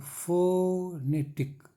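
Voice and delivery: Phonetic very slow, FOOO-NE-TIC; syllable breakdown. Shown as a demonstration. Teacher counting puffs of air in phonetic, slow syllable-by-syllable delivery. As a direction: break phonetic into three beats, long first O. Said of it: "तीन हवा का झोंका".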